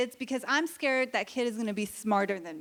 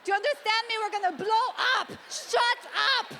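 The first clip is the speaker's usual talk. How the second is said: furious mom; 7 semitones higher than usual. Retold or imitated. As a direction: yell at the kid in the back seat. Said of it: "I'm just gonna be like".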